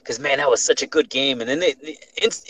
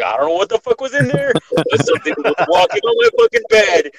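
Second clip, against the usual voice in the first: panicked; 8 semitones higher, 5 dB louder than usual. Retold or imitated. Panicked, running to uncle.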